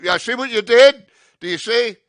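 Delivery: loud high scolding quote; hammed-up scolding of the driver; mock scold. Comic scolding someone over what they did, loud mock scold.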